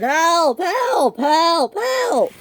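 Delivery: repeated high squealed yells; cry for help. A narrator's reading. Both derailed engines cry for help. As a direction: shriek each one in panic.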